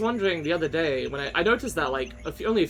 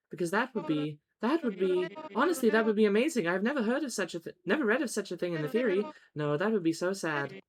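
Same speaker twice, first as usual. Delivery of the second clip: Mesmer; 4 semitones higher than usual. Acted. Mesmer pleading with a pupil who won't use magic, mildly dismayed. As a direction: coax a reluctant pupil in a measured character voice. Mesmer, saddened, coaxes the player.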